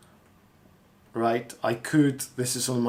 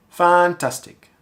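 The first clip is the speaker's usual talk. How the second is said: "fantastic" higher and louder; emphatic word practice; 5 semitones higher, 8 dB louder than usual. Shown as a demonstration. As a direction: punch the "fan" in "fantastic". Voiceover warm-up, repeating a word.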